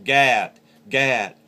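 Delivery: GAT drawled out loud and high, southern style; loud southern-drawled goose-call GAT. Shown as a demonstration. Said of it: "kind of a southern drawl".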